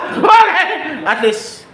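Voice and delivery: high and loud, laughing; excited laughing okay. Real, not acted. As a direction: blurt it out mid-laugh. Laughing exclaim. (masiglang okay habang tumatawa)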